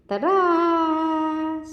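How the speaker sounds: high, bright ta-da flourish; excited ta-da. Real, not acted. Teacher shows the picture with an excited ta-da. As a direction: announce it like a reveal.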